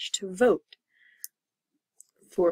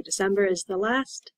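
usual speaking voice

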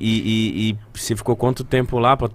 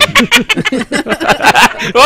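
high cackling little witch laugh; witchy giggle; 11 semitones higher, 5 dB louder than usual. Real, not acted. Friend cracks up at the Texas joke, high witchy giggle. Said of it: "risadinha de bruxinha".

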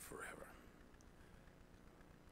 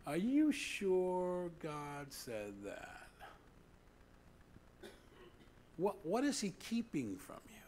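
slow, higher, whispered serpent voice; Satan tempting; 7 semitones higher, 3 dB quieter, slower than usual. Retold or imitated. Satan whispering doubt to Adam and Eve, sly. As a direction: hiss the doubt into their ear like the tempter. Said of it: "The butthead, Satan".

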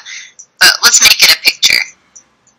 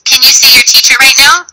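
ordinary talk

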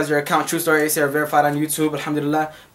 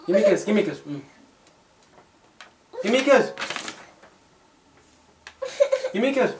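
soft playful coax; 4 semitones higher, 5 dB quieter, much faster than usual. Real, not acted. Mom softly coaxing her baby for a kiss.

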